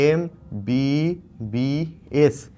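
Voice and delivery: MBBS said letter by letter, very slowly; teacher announcing the course name to students; slow emphatic naming. Real, not acted. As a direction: draw out MBBS so every letter stands.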